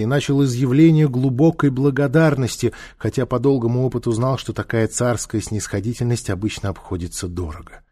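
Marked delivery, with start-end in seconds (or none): none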